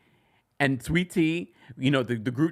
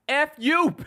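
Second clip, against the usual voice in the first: high, loud pretend retort; mock outburst; 6 dB louder than usual. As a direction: fire it back at her like she earned it. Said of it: "I probably would have then said".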